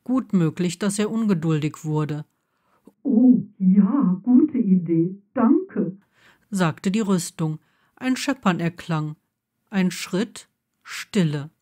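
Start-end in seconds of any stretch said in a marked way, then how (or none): 3.0-6.0 delighted armor. Acted.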